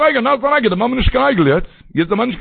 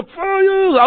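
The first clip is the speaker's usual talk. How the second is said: watchman's shout; 12 semitones higher than usual. Retold or imitated. Watchman on the tower warns the sleeping town, shouting. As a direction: yell it out like a watchman sounding the alarm. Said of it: "שרייט ער".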